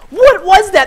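high, loud burst of disbelief; startled exclamation. Real, not acted. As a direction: blurt it out, astonished. Startled by a giant weapon.

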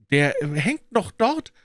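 put-on high, slow boy's voice; Jungenstimme. A narrator's reading. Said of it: "antwortete der". (hohe, langsame Knabenstimme)

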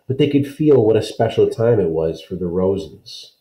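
plain reading voice

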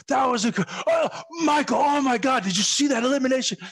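high, excited, gushing rave; gushing Pat Patterson. Retold or imitated. Pat Patterson gushing to the wrestler, high excited rave. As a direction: rave about the spot like an overjoyed fan. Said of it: "Pat was like".

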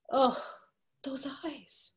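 breathless whispered sigh. Acted. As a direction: whisper it as a secret thrill. Gertie's secret longing.

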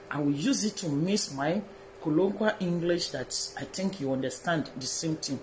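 humble, soft-spoken pleading; sincere plea. Real, not acted. Earnest plea to governor.

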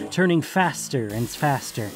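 ordinary delivery

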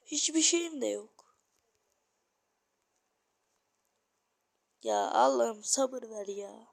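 loud, fast, outraged rant; furious complaint. Real, not acted. Gamer ranting after a creeper killed him, loud and angry. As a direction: vent real anger at dying.

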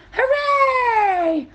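very high, drawn-out animal cheer. A narrator's reading. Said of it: "There was a loud cheer".

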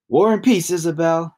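loud, high call across the street. A narrator's reading. Dad calling back to wife while walking away, loud and playful. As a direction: call it out playfully as you walk off. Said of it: "he called out".

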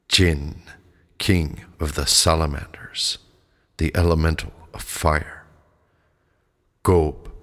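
ordinary delivery

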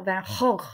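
"Van Gogh" with a soft rasp from the back of the throat and no end G; throaty Dutch G. Shown as a demonstration. Teacher showing kids the Dutch throat sound. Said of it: "this sound right here comes from the back of your throat".

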